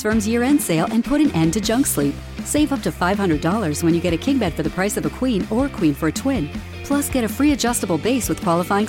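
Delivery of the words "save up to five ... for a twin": ordinary delivery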